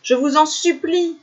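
high, acted plea; imploring. A narrator's reading. Ailibu begging the villagers, high imploring voice. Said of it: "implora Ailibu".

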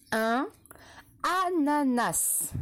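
"ananas" drawn out slowly syllable by syllable; slow dictation. Shown as a demonstration. Teacher dictating to young pupils, slow and careful. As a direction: stretch each syllable of "ananas". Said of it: "Attention, deux mots".

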